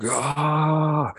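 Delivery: mock wailing cry, high; a short hammed-up wail; imitating a drunk man wailing in the street; imitated wail. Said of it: "The wailing, like".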